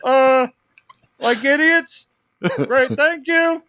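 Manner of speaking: high, timid Kermit-like voice calling to the crew; George Lucas directing. Retold or imitated. George Lucas directing stormtroopers, high and meek. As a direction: politely fumble instructions to extras in a high little voice. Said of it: "I try to imagine him directing".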